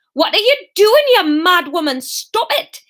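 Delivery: high, loud panicked shriek; Norman's shriek. Acted. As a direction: shriek at her to stop, voice leaping up. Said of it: "Norman shrieked".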